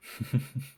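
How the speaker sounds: short, breathy chuckle. Acted. Vampire amused by own admission.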